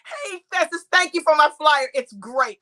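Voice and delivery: normal talking voice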